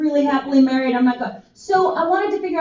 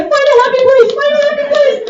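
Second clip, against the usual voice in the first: high and louder, desperate chant; panicked starfish; 13 semitones higher, 5 dB louder, faster than usual. Retold or imitated. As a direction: squeal it while being pried loose. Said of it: "as she's getting peeled off and Darla is banging on her".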